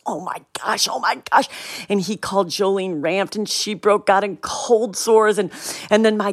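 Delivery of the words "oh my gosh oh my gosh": nervous dad. Retold or imitated. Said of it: "he really did get nervous".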